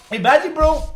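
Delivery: high, loud, worked-up "imagine"; excited. Real, not acted. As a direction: pitch it up with excitement. Excited point in an argument.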